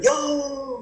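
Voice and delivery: high, drawn-out mock holler; goofy phone greeting. Cricketer answering what he thinks is a friend's call, goofy high greeting. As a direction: answer the phone with a silly whoop. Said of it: "you'd say something stupid when you answer".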